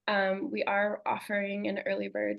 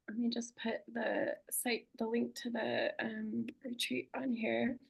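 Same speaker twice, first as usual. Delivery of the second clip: whispering; 3 semitones higher, 6 dB quieter than usual. Real, not acted. Aside while posting the link.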